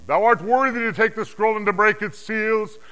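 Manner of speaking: high, loud declaimed anthem; triumphant, forceful acclamation; exultant hymn of praise. Acted. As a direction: proclaim it as a soaring song of worship. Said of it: "they were singing a new song".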